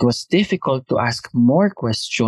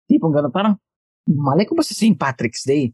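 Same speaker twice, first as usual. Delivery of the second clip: ordinary talk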